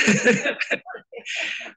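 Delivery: a very high, breathy giggle; laughing at own worry; real giggle after doubting a friend's choice. Real, not acted.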